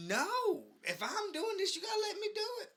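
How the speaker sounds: high-pitched mock outrage; mock indignant. Real, not acted. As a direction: squeak it out in pretend protest. Mock-indignant at co-host butting in.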